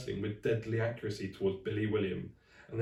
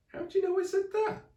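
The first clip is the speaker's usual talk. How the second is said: put-on much higher surprised girl; astonished girl voice; 4 dB louder than usual. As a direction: squeak it out in disbelief. Polly astonished, voiced character.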